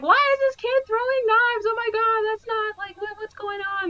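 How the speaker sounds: very high, mock-panicked squeal of disbelief; alarmed disbelief. Cops reacting to a knife-throwing kid, alarmed disbelief. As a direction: imitate a rattled group in high-pitched alarm.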